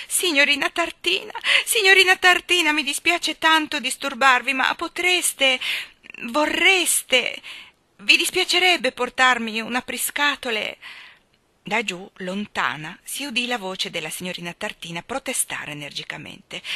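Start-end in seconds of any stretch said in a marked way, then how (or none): 0.1-10.8 high, loud, polite call through a crack. A narrator's reading. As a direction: call out loudly, hesitant and apologetic. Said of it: "chiamò attraverso la fessura". (richiamo forte, acuto ed esitante)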